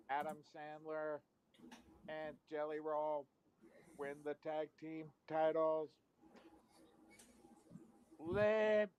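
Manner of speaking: slow, even robot delivery. Acted. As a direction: read it like a machine. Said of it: "it's the robot".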